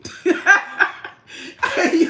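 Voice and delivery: loud, high burst of laughter; big laugh. Real, not acted. To a viewer questioning her house, loud laughter.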